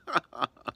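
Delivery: a burst of disbelieving laughter; startled, amused laugh; real laugh. Real, not acted. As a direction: laugh out loud at the irony. Laughs at mom's dating news.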